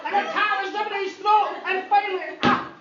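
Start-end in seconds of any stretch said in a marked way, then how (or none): none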